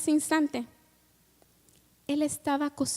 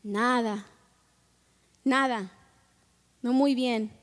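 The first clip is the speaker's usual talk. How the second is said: low, softened, dejected replies; a touch of glum defeat; downcast; 3 semitones lower than usual. Acted. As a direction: answer like disheartened fishermen. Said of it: "Y ellos todos cabizbajos".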